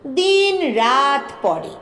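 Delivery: usual speaking voice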